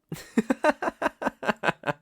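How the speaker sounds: high, loud mocking cackle; a sinister host's gleeful laugh; villain laugh. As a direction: cackle at her disbelief. Villain mocks skeptical guest.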